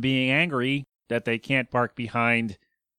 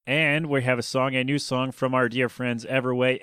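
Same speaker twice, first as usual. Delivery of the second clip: ordinary talk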